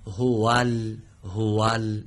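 هوال said as one joined syllable, alef dropped; joined reading. Shown as a demonstration. Teacher models the joined sound for students. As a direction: glide هو into ال as هوال.

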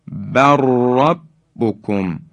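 بر ربکم joined, r held and rolled; slow demo, long r in بر ربکم; doubled ra. Shown as a demonstration. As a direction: trill through بر into ربکم.

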